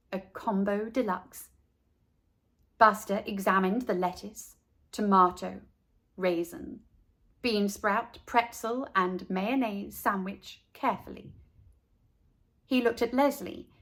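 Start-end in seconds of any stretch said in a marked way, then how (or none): none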